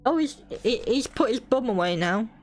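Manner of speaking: usual speaking voice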